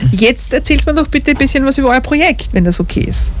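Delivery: ordinary talk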